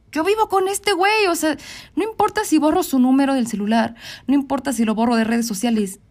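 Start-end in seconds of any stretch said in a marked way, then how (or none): 0.1-1.3 high-pitched retold dismay; mock-alarmed, high exclamation; exclaimed realization. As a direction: relive the shock, pitch jumping way up.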